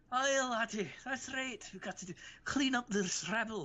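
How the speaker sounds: high, hearty Scottish brogue; Scottish accent. Acted. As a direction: put on a wobbly, excitable Scottish accent. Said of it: "I made him sound Scottish".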